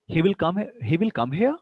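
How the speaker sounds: doubt it by lifting "here" at the end; rising questioning "He will come here"; statement as question. Shown as a demonstration. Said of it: "rising tone".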